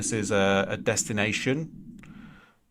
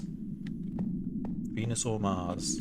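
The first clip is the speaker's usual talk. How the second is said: drawn-out soft repeat; 7 dB quieter, much slower than usual. Shown as a demonstration. Asking viewers to vote, repeated.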